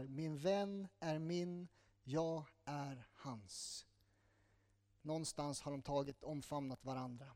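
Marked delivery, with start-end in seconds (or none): 0.0-3.9 very slow, softer, savouring each word; tender quote. Acted. The bride speaking of her beloved, slow and tender. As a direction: read it as a whispered-close love vow. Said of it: "säger hon så här".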